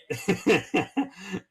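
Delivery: burst of laughter. Real, not acted. Laughing at own joke.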